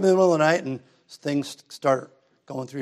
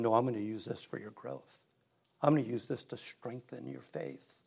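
soft voice of God; 10 dB quieter than usual. Acted. God's reassuring words to us.

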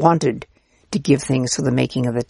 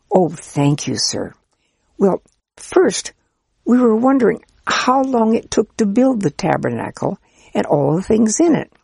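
put-on higher, eager, polite child asking the priest; eager child's voice; 6 semitones higher than usual. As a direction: play a keen, polite little child.

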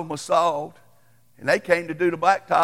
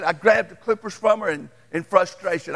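ordinary delivery

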